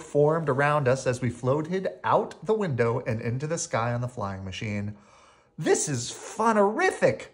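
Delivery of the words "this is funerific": higher, gleeful mock scream; thrilled, without a full scream; excited scream. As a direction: cry out with delight. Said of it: "I screamed while we zipped".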